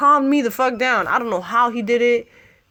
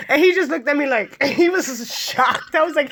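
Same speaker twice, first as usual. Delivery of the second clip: ordinary talk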